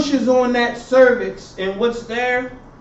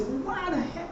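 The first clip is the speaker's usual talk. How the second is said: puzzled student; 7 semitones higher, 11 dB quieter than usual. Retold or imitated. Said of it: "And you may say".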